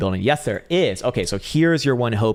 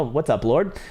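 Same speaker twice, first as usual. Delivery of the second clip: ordinary delivery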